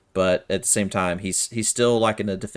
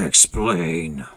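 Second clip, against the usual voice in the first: gruff creature demand; 6 dB louder than usual. Acted. Wounded creature, eyeing the party, demands an explanation gruffly. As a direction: bark a one-word order like a wary beast. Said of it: "kinda look at you and go".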